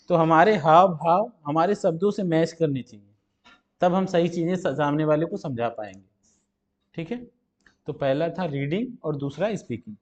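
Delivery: usual speaking voice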